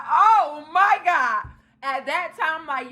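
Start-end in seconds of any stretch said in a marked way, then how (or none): 0.1-1.5 slow, high-pitched exasperation; exasperated disbelief. Retold or imitated. Retold exasperated reaction.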